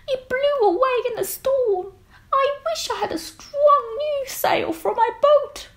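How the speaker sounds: a sad little goat on a boat. Acted. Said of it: "said the goat".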